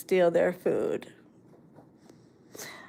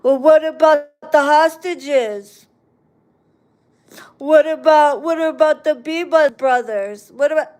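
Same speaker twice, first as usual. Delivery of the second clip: higher, louder sneering whataboutism; mocking a heckler; 5 semitones higher, 6 dB louder than usual. Mocking a critic who brings up the hostages, higher and louder. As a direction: parrot the dumb schmuck's "what about", hammed up. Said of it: "that comes in and says".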